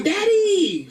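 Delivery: put-on high-pitched excited child's squeal; excited little daughter. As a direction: cry out like a thrilled toddler. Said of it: "She was excited".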